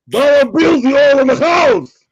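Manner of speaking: high, very loud hype announcement; Hungry Shark shout. Acted. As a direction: bellow a hammed-up arena introduction. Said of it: "the Hungry Shark would like to welcome".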